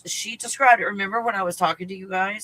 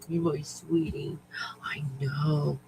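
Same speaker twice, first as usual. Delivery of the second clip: soft whisper to a pet; 7 dB quieter, slower than usual. Real, not acted. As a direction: whisper it tenderly to the puppy. Cooing to her dog.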